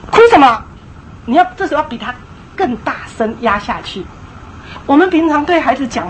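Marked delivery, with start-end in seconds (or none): 0.1-0.6 loud sharp parental rebuke; stern scolding. Retold or imitated. Parent cutting off a crying child, loud stern rebuke. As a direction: snap at the crying kid to stop. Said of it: "你就说".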